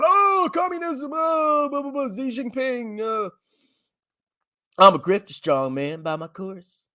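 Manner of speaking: high, slow scoffing caricature; mock panicky bear. Vlogger mocking bearish grifters after China dips, high scoffing caricature. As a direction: sneer like a doom-selling grifter. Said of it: "And then China goes down".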